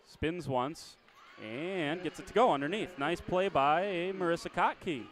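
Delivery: brisk live game call, rising with the action; play-by-play. Acted.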